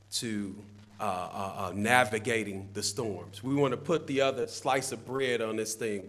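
normal talking voice

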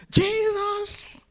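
very high, loud, drawn-out scream; full-throated holler stretched long. Acted. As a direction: belt out the name in defiance. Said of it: "He cried even louder".

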